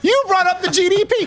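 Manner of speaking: high, exasperated comeback with a laugh; incredulous. Retold or imitated. Speaker answering Chicago guy's GDP complaint, incredulous.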